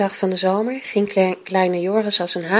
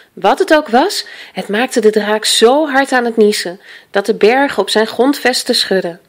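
usual speaking voice

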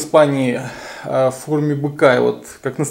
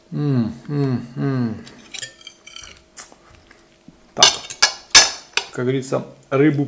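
humming while chewing food; chewing with mouth closed. Real, not acted. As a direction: mouth full of chicken. Seen 0: Closed mouth with cheek movement shows chewing while he hums.

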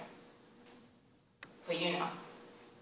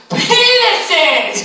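laughing exclamation; 9 semitones higher, 5 dB louder than usual. Real, not acted.

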